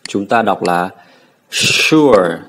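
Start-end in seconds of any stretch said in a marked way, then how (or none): 1.5-2.4 "sure" with a long, emphatic sh; heavy SH sound. Shown as a demonstration. Teacher stressing the sound for students, heavy SH. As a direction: lean hard on the "sh" of "sure". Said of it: "Đọc rất là nặng".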